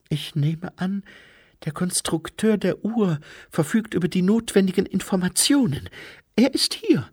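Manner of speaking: high-voiced lady informant; Lady Lejeune. Lady Lejeune telling them where the constructor is, high-pitched voice.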